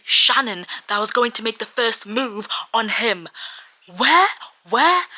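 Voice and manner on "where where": high, loud disbelieving cry; shocked cry. Real, not acted. Shocked fan demanding where the signal was. Shocked outburst about book.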